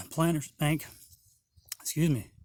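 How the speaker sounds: normal talking voice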